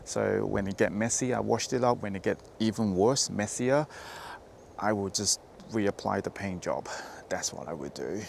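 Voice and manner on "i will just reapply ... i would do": unvoiced, breathy aside; whispering. Real, not acted. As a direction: whisper the last joke. Quiet sign-off joke.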